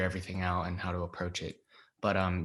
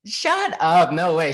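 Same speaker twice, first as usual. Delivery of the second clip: loud, high burst of retold amazement; excited disbelief; 8 semitones higher, 10 dB louder, slower than usual. Actor hears from manager they booked the film, excited disbelief. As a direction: burst out as if you can't believe the news.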